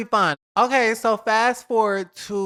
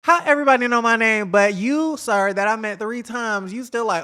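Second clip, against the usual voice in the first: ordinary talk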